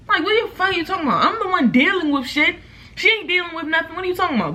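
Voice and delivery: high, exasperated comeback; high-pitched retold argument; acted indignant retort. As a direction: throw it back in disbelief, pitch jumped up. Acted retort to meddling neighbor.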